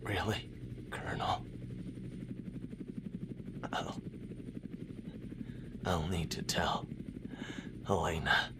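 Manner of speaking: wounded, barely voiced; faint injured whisper; weak whisper. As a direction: breathe the words out in pain. Wounded soldier fading out.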